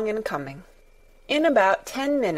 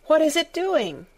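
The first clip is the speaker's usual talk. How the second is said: put-on higher, lightly excited boy's question; a touch of wondering delight; eager cry; 8 semitones higher than usual. Colin watches the lamb nuzzle him, mildly excited. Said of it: "cried Colin".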